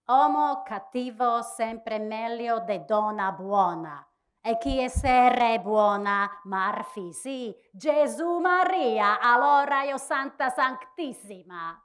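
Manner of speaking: preaching in rough Italian, rising to high exclamations; preachy Italian. Acted. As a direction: predica in italiano stentato. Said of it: "preached in her poor, tough, and yet charming Italian".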